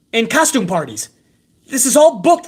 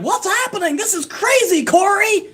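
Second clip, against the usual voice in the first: mocking Jimmy Smith; 10 semitones higher than usual. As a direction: mimic a gushing announcer, pitched way up. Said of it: "That's what Jimmy Smith is saying".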